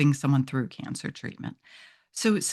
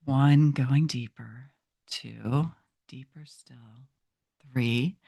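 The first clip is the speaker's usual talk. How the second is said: slow whispered countdown, sinking lower; hypnotic countdown; 3 semitones lower, slower than usual. Shown as a demonstration. Hypnotist demonstrating her self-count, in a slow whisper. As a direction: whisper each number slowly, drifting down. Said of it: "and I'll just say".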